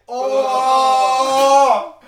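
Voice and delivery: high, drawn-out shout of delight; excited shout. Real, not acted. Cheering as the result comes in, a high, loud, drawn-out oh. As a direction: let the oh soar, very slow and loud. Reacting to the winning moment.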